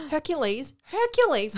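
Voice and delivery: calling out. Retold or imitated. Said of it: "his dad just".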